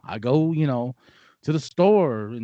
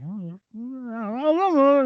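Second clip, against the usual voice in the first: lightly mimicked woman, drawn-out and higher; slow, higher mock of someone dithering; imitated clueless woman; 8 semitones higher, much slower than usual. Mimicking a maskless woman to a friend, drawn-out mockery.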